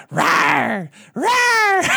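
playful high rawr growls; cute growl. Retold or imitated. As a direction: growl like a cute little monster. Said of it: "lady going like".

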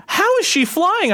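put-on high, slow, wide-eyed kid wonder; amazed little girl. Dad imitating his daughter's amazement, high and slow. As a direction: sound like a child seeing magic.

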